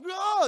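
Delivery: high, loud mock yell; cartoonish holler of someone swinging away; imitated startled shout; falling cry.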